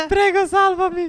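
very high, whining plea; mock desperate plea. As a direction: beg for rescue in play panic. Begging to be saved from quicksand.

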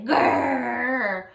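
playful drawn-out bear growl; polar bear growl. Shown as a demonstration. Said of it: "are you growling like a polar bear?".

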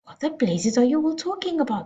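high, exasperated father's outburst; yelled. A narrator's reading. Father demanding answers from his family, yelled. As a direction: burst out, baffled and high-pitched. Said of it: "the father yelled".

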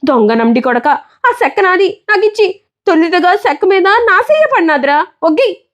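put-on high, heated street-boy demand; angry demand. Boy fighting the bully for his coconut piece, high and heated. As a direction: shout him down for the piece.

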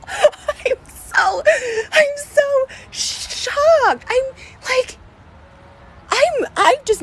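real shocked sobbing; crying out. Real, not acted. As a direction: wail it through tears of shock.

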